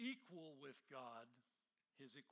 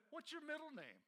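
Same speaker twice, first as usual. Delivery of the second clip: mock suspicious demand; 9 semitones higher than usual. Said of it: "I said".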